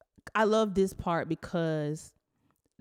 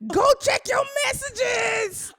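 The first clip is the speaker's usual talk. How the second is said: excited shout; 6 dB louder than usual. Retold or imitated. Sister yelling at Jessica to check her messages. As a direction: shriek it across the room.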